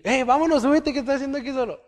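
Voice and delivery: high, loud, fast hail to a stranded man; calling out from a boat. Retold or imitated. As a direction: shout across the water, hurried and urgent. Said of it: "un barco y otra persona".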